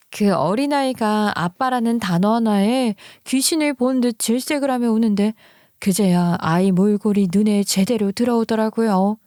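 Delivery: ordinary delivery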